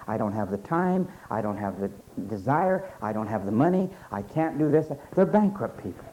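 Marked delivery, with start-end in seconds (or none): 0.1-4.9 low, softer mock excuses; mumbled excuse. Preacher mocking bankrupt people, muttering low. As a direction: mumble the excuses like you've given up.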